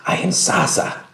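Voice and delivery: high, airy breath of a voice; lightly voiced Saza. Saza introducing herself to the newcomers, high and airy. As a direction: pitch it up and breathe the name.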